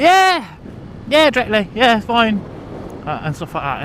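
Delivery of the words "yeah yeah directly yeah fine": mock easygoing locals, higher pitch; a high drawn-out yeah, then casual; breezy agreement. Locals brushing off an open-ended promise, breezy agreement. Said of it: "but they're all like".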